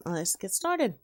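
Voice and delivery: plain reading voice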